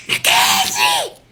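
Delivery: high, drawn-out scream for a daughter; screaming at the top of the voice. Retold or imitated. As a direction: scream the name out as loud as you can. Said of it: "I'm screaming on top of my voice".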